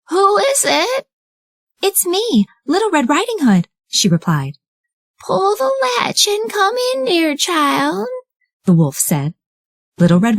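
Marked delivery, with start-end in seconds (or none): 0.1-1.1 high put-on old lady voice; the wolf sweetly pretending to be grandmother; wolf in disguise imitating grandmother; wolf faking granny. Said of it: "disguised his voice, this time to sound like grandmother". 1.8-3.7 put-on high, sweet little girl. Red at the door. 5.2-8.1 high, slow fake grandmother voice; a not-quite-right granny impersonation; wolf disguising his voice as grandmother; wolf faking granny. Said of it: "disguised his voice, this time to sound like grandmother".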